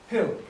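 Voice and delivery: teacher's drill prompt; drill model. Shown as a demonstration. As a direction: clear model word for repeating. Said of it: "Get them to repeat from the end".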